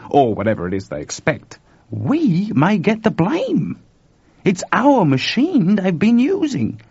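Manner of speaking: lightly voiced Chuck, eager and uneasy; excitable Chuck. Chuck's voice in the story.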